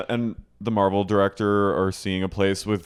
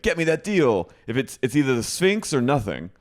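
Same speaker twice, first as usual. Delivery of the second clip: higher-pitched mock phone demand; demanding director; 6 semitones higher than usual. Director on the phone insisting on his deal, mock demanding. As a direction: bark the order like a hammed-up film director.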